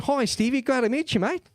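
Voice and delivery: put-on a breezy Aussie greeting, Australian accent; friendly Australian accent, not overdone. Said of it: "That Australian accent".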